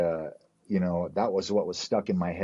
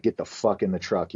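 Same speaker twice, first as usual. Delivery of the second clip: usual speaking voice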